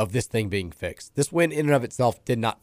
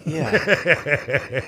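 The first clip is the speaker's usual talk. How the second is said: gangster voice; 5 semitones higher than usual. Pretend. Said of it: "fifties just gangster".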